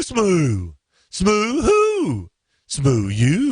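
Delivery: "smoo" said as one word, moo-like, playfully. Pretend. Host mispronouncing SMU as smoo to tease co-host. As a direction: turn "smoo" into a silly rhyme.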